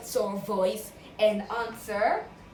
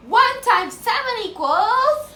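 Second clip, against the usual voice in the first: loud, high, slow question; big excited prompt; 10 semitones higher, 12 dB louder than usual. Shown as a demonstration. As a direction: hype them up to roar. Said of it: "Use the dinosaur voice".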